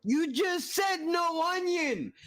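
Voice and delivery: high, slow, stretched-out indignant shout; mock outrage. Yelling at the cooking video cook for adding onion, high mock outrage. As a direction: yell it back at the screen like you've been betrayed.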